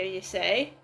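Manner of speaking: ordinary delivery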